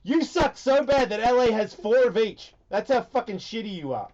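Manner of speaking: loud, high-pitched trash talk; mock angry taunt. Host trashes San Diego fan's teams, mock angry taunt. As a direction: pile on with hammed-up outrage.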